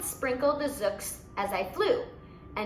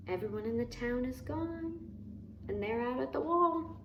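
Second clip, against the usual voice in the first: much softer, half-whispered; hushed aside; 9 dB quieter than usual. Real, not acted. Reader to child, a much softer hushed aside. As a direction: lean in and hush it. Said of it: "hushed aside to listener".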